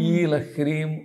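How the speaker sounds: ordinary talk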